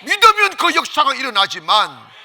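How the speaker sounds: loud, high, driving pulpit voice; ringing, insistent sermon climax; full-force preaching. Real, not acted. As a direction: proclaim it to the whole congregation.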